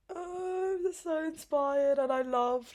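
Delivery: high, mock-tearful gushing; overcome with emotion. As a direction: gush in a high whine that slowly comes down. Gushes tearfully over the documentary.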